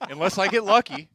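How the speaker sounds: high, loud voice breaking into laughter; real laughing. Real, not acted. Player admits there's no way unless lucky, laughing hard and high. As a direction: squeal it out through helpless laughter. Cracks up while missing shots.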